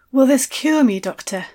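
breathless. Acted. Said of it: "I asked, breathlessly".

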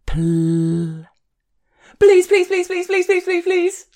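high, loud rapid begging; a desperate lover rattling off pleases; machine gun pleading. Acted. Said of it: "machine gun barrage of pleases".

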